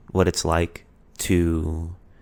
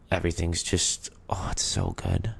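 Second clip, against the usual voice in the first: soft dreamy whisper; 7 dB quieter than usual. Retold or imitated. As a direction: breathe it out, blissful. Dreamy honeymoon gushing.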